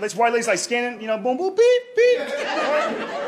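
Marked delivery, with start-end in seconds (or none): 1.2-2.2 high imitated register beeps; scanner beeps. Comic imitating a grocery scanner, high beeps. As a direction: chirp like a checkout scanner.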